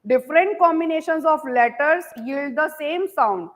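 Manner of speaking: very slow, word by word English dictation. Shown as a demonstration. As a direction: dictate each word slowly so students can write. Teacher dictating English sentence.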